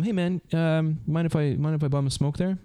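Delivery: breezy, friendly ask to a stranger; relaxed dude asking to bum a smoke; casual ask. Retold or imitated.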